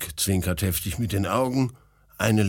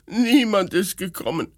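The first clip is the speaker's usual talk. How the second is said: high, weeping complaint; tearful; 11 semitones higher, 4 dB louder than usual. A narrator's reading. Princess complaining of absent guests, tearful and high. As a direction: lament it as a crying princess. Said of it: "weint die Fürstin".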